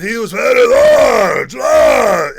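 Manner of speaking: high, loud booming shout; roaring giant; huge giant voice. Shown as a demonstration. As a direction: bellow like a giant. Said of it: "was this huge".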